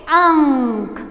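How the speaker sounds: "अंक" with the nasal held long; model pronunciation. Shown as a demonstration. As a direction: say "अंक" drawn out for repeating. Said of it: "मेरे साथ बोलो". ("अंक" को खींचकर, नाक से गूंज के साथ)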